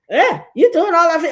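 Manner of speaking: hammed-up nosy gossip; nosy mockery. Nosy people questioning the wife, high mocking tone. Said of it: "people used to be like".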